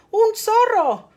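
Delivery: high, loud alarmed storybook cry; farmer's cry. A narrator's reading. Storyteller voices the farmer spotting a fox, crying out. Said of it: "gritó el granjero".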